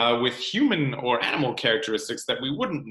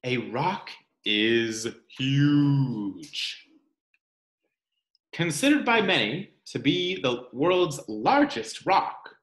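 expressive storybook read-aloud; reading to children; 3 semitones higher, slower than usual. Acted. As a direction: read it like story time for little ones. Reads picture book to children.

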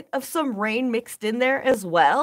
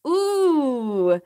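whispered ooh. Real, not acted. Reacting to chat, breathy.

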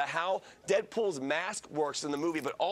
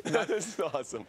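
laughing. Real, not acted. Laughing through it.

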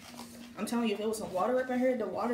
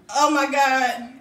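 slow, loud exclamation; loud, drawn-out exclaim; 5 semitones higher, 12 dB louder, much slower than usual. Real, not acted. Reacting to spicy burn.

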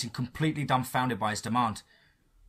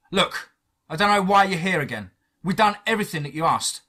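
raised, indignant protest; higher, louder angry complaint; 6 semitones higher, 5 dB louder than usual. A narrator's reading. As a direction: push back with heated exasperation. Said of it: "I said angrily".

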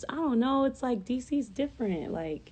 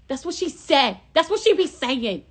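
higher, louder hyped repeat of That's what she said; 6 semitones higher, 5 dB louder than usual. Shown as a demonstration. Vlogger hyping the quote, louder and higher. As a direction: punch it up with hype.

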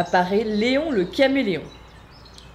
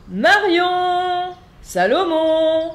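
calling out; 9 semitones higher, 6 dB louder, much slower than usual. Acted. Calling the butterfly friends.

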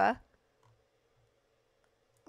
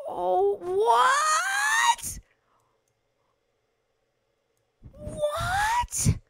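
very high, loud squealing what; delighted shriek; 5 dB louder, much slower than usual. Real, not acted. Streamer shrieking at a find in the game, overjoyed. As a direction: shriek in joyful disbelief.

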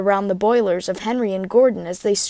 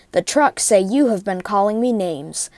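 usual speaking voice

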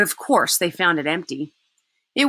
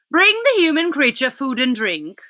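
witch commanding; 7 semitones higher, 4 dB louder than usual. Acted.

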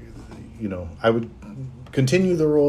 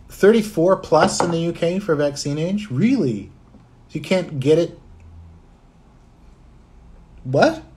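higher, incredulous questioning; real disbelief; 6 semitones higher than usual. Real, not acted. Streamer reacting to UK vaccine age limit, incredulous. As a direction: pitch it up in astonished disbelief.

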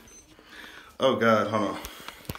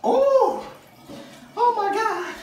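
very high pained squeals; squealing from the cold; much slower than usual. Real, not acted. Enduring ice bath.

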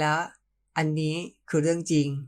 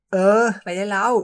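loud, higher embarrassed shoo; louder bashful get-going; shooing away; 4 semitones higher, 7 dB louder than usual. Acted. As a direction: chase him off loudly to hide the happiness. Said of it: "ผมเอ่ยปากไล่".

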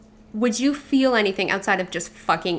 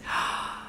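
whispered gasp; 9 dB quieter than usual. Real, not acted.

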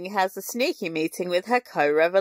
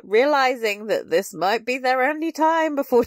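normal talking voice

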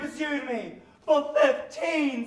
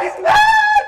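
"mad" shrieked far higher and louder; a panicked shriek with "mad" at the top; 10 dB louder than usual. Acted. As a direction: push "He's mad!" up into a shriek. Repeated cry, much higher.

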